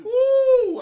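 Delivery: loud joyful whoop; excited whoop. Real, not acted. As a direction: let out a big happy cheer. Excited cheer over a pick.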